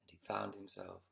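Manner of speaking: put-on gentle storyteller reading to children; bedtime story reading. Reads bedtime story aloud.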